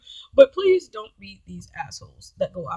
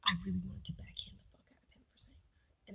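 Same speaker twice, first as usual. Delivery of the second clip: hushed aside, nearly all breath; whispered exasperation; 2 semitones lower, 20 dB quieter than usual. Real, not acted. Woman confessing urge to slap date, whispered exasperation. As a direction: confide it in a seething whisper. Confides suppressed rage after his whine.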